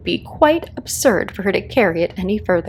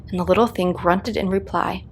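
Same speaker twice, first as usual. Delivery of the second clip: ordinary delivery, nothing marked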